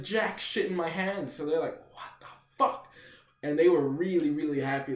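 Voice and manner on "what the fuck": voiceless, whispered disbelief; imitated bewilderment. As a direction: mimic baffled friends in a whisper. Friends' baffled reaction, acted.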